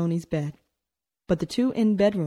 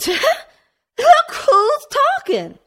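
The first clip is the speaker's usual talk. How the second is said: much higher, louder, slow barked retort; 9 dB louder, much slower than usual. A narrator's reading. Tony snaps back at his sister, barking loud and slow. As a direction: fire it back at her. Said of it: "he barked".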